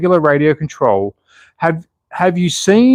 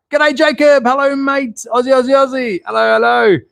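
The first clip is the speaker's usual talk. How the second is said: excited high greeting; 11 semitones higher than usual. Real, not acted. Greeting chat viewers excitedly.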